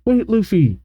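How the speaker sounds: high-pitched call at normal volume; mock shout. A narrator's reading. Usopp calls after runaway Luffy, high mock shout. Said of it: "Usopp shouts".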